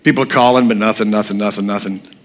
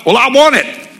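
much higher, happy buyer insisting; 13 semitones higher than usual. Retold or imitated. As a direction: squeak it out like a keen buyer. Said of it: "he was all happy".

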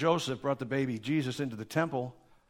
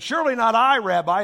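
louder, higher fake innocence; feigned innocence; 6 semitones higher, 8 dB louder than usual. Judas denying to Jesus, loud mock innocence. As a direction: play innocent, a bit too loudly.

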